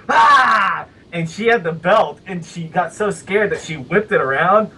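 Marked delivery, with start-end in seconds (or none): 0.1-0.9 sudden high shout out of hiding; jump-scare yell. Retold or imitated. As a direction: leap out and yell to scare mom. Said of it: "I go".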